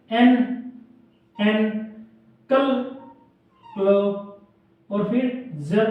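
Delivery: एन, कल, क्लो, जर split into separate syllables. Shown as a demonstration. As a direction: break एनक्लोजर into its parts with pauses. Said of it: "एनक्लोजर".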